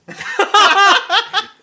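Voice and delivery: big high laugh; loud laugh. Real, not acted. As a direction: burst out laughing. Laughing at own joke.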